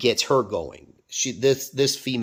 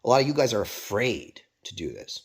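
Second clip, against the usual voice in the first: whisper. Real, not acted. Confiding to viewers.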